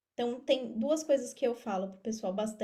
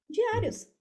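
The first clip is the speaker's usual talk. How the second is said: Diários much higher, hit hard; emphatic repeat; 10 semitones higher than usual. Real, not acted. Stressing to listeners that entrepreneurs face daily challenges, emphatic. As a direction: punch Diários up high.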